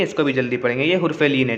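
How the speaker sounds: ordinary talk, nothing marked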